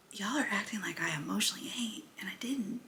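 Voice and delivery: whispered, fast and conspiratorial; whispered aside. Retold or imitated. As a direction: lean in and whisper the retort. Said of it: "'cause I was like".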